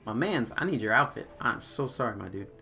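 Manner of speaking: ordinary talk, nothing marked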